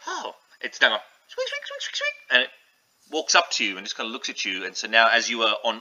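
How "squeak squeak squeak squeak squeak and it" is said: very high squeaky rat noise. Acted.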